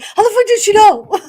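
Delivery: high, loud laughing disbelief; mock astonishment. Imitating Mark stunned by her guess, laughing high disbelief. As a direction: squeal it in mock shock. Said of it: "Mark was probably like".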